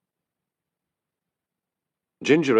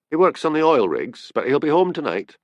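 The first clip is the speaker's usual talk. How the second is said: higher, cheerful explanation; 7 semitones higher, 4 dB louder than usual. A narrator's reading. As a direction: chat on brightly about the husband. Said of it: "said Annie cheerfully".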